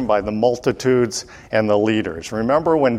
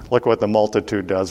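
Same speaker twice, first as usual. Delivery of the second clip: usual speaking voice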